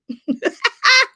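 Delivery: a burst of high laughter; bright amused laugh. Real, not acted. Laughing at her own joke.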